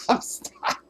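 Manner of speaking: high, squeaky helpless laughter; cracking up. Real, not acted. Still laughing at the Lassie sketch, squealing through laughter. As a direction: talk while losing it laughing. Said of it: "I'm still dead over that".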